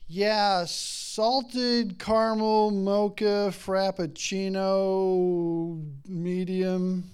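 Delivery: loud, slow, drawn-out order; mock daughter ordering loudly; daughter's big order. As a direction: stretch out the fancy drink order. Daughter ordering at drive-thru.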